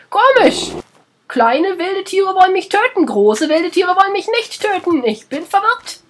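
high, loud, slow puzzled voice; squeaky exaggerated confusion, drawn out; mock confusion. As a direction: play it up as hammed-up bewilderment. Mock bafflement at the animals.

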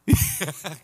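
laugh. Real, not acted. Teacher laughing at the student.

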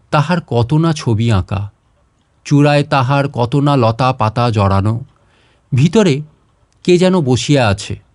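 plain reading voice

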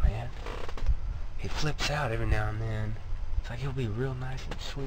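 low, hushed nervous talk, kept under his breath; nervous hushed griping; hushed venting. Real, not acted. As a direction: keep it down so the trainer won't hear. Venting secretly near trainer.

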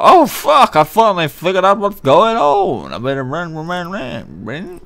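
high, loud, hammed-up panic fading as he flees; mock panicked guard. Gamer mocking a fleeing guard, high hammed-up panic. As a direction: squeal it out like a dim guard finally catching on. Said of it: "That's exactly how they talk".

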